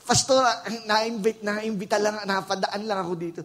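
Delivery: high, loud pleading excuse; mock sheepish visitor. Visitor confessing to the pastor, high sheepish pleading. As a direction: squeak out the excuse like a caught thief. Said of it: "di ko nga alam ba't ako nag-church".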